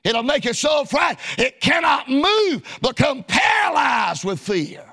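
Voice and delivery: ordinary talk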